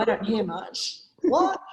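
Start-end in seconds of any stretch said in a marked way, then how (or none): 1.2-1.6 sharp high startled what. Retold or imitated. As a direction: blurt it out, caught off guard. Startled shout in swim story.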